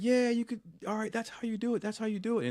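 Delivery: high, excited imitation of cheering friends; cheering approval. Guys cheering Tyler after his turn, excited.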